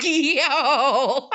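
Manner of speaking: drawn-out yo breaking into laughter; real helpless laughter; cracking up. Real, not acted. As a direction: howl it, then dissolve into laughing. Cracks up at sister's threat.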